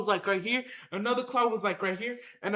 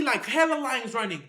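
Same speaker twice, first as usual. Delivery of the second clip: ordinary talk, nothing marked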